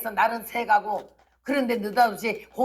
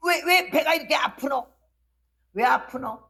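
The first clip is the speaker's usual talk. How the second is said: retold cry of pain; 5 semitones higher than usual. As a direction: cry out as if stabbed in the belly. Stomach pain like stabbing.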